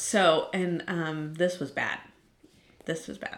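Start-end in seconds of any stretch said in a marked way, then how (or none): 2.8-3.4 This was bad breathed out softly with no voice; whispered. Real, not acted. Friend mourning her dog, whispering softly to the audience. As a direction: whisper the repeat as the grief sinks in. Repeats grief line twice.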